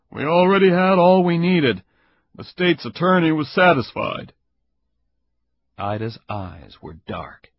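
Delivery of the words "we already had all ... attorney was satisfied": louder, fast, blunt man's voice; stern investigator, irritated. Acted. Caperuzzi threatening Ida with a complaint, loud and fast. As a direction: push through it like an angry official.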